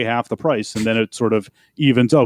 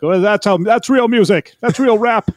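higher, louder retold outburst; playfully emphatic self-quote; mock indignant proclamation; 8 semitones higher, 4 dB louder than usual. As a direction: proclaim it to the kids in mock outrage. Acting out mock lecture to kids.

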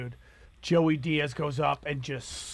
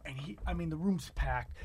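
ordinary delivery

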